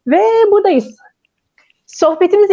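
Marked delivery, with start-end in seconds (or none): none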